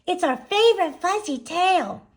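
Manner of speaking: put-on high, loud, gushy little girls; gushing. A narrator's reading. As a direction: squeal it with delight. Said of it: "gushed the girls".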